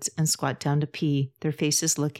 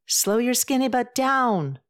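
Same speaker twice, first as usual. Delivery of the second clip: yelling after someone running away; shouting; 9 semitones higher, 4 dB louder than usual. Acted. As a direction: call it out loud and high. Calling after friend running off.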